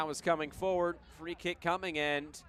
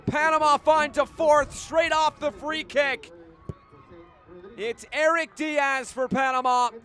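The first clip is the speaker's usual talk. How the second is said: loud, high, thrilled goal commentary; excited goal call; 9 semitones higher, 12 dB louder than usual. Real, not acted.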